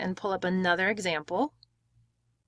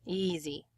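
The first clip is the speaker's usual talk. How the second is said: "Easy" with a long first syllable, softly falling off; stress on first syllable; 2 semitones lower, 9 dB quieter than usual. Shown as a demonstration. Teacher echoing the model, "Easy" with first-syllable stress. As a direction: draw out the start of "Easy", let the end fade. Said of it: "use this to look at syllable stress".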